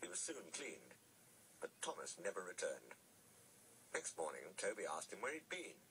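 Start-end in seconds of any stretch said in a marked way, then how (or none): none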